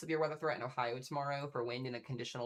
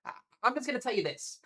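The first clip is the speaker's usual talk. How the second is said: excited; 10 semitones higher, 6 dB louder than usual. Real, not acted. Hyped about tonight's storm forecast.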